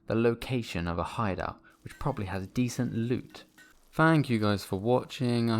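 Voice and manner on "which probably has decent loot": normal talking voice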